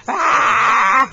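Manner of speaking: loud playful rawr; bear roar. Shown as a demonstration. Showing the toddler a bear roar, loud rawr. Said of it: "Can you say rawr?".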